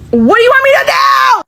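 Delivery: high fast exasperated yell; real exasperated shout at the commenters. Real, not acted. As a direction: throw up your hands and yell it. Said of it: "exasperated outburst".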